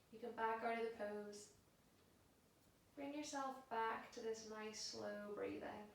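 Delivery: soft, unhurried yoga relaxation voice; guided meditation. Acted. Yoga teacher settling class into a held pose, soft and calm. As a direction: guide them into stillness, hushed and spaced out.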